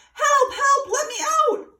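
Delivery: very high, loud trapped cry; muffled cry for help. A narrator's reading. Bunny trapped in the sack cries out, high and loud. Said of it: "called out in a muffled voice".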